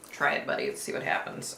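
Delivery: low whispered dare; taunting whisper. Acted. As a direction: whisper it like a challenge. Taunting the accused.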